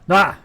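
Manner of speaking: short, sudden loud yelp; startled yell. Real, not acted. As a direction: cry out as if caught off guard. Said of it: "All you're gonna hear is me yelling".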